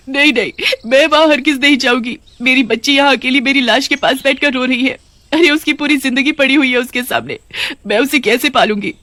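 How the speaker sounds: begging not to be taken away; desperate refusal. Acted. Dead mother refuses the witch queen, loud and desperate.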